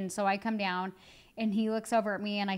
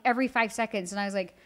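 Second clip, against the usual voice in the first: ordinary delivery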